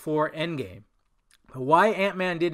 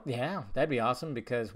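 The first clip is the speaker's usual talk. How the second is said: ordinary talk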